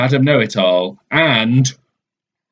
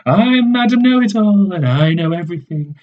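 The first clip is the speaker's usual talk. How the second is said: smug and certain, pitched way up; Madam Know-It-All; 8 semitones higher than usual. Acted. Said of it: "she says".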